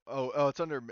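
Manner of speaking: ordinary talk, nothing marked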